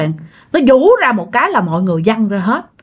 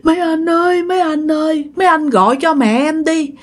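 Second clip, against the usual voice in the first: high, sustained mock-crying wail; acted weepy plea, repeated in a high whine; imitated tearful pleading; 8 semitones higher than usual. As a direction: beg for mother like someone crying, pitched well up. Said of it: "Mà vừa khóc vừa nói vậy".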